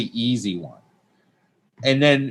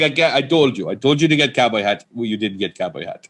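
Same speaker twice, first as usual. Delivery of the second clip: put-on scolding Persian accent. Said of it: "He was like".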